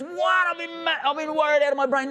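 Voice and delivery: high, rushed worried voice; a frantic man who was worried sick; worried husband. Retold or imitated. Husband finally seeing his wife again, voiced high and frantic. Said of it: "And he goes".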